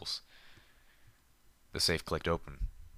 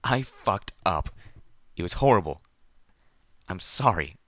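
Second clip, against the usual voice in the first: bursting into tears; 7 dB louder than usual. Acted. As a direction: break down as the words come out. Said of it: "bursting into tears".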